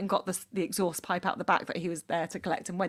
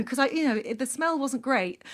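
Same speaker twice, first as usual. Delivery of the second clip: ordinary talk, nothing marked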